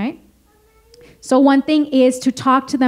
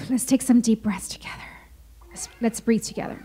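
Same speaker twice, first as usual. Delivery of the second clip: soft whispered breathing guide; breathy whisper; 2 semitones lower, 7 dB quieter than usual. Shown as a demonstration. Guiding child through deep breathing.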